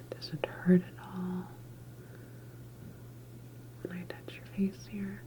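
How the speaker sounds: ordinary delivery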